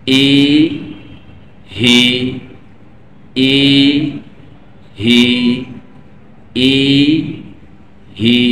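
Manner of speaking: ই and হি said one by one with pauses; separate syllables. Shown as a demonstration. Teacher modelling each syllable separately for learners. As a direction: sound out ই, then হি, clearly apart. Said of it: "আমরা সেটা পৃথকভাবে উচ্চারণ করি".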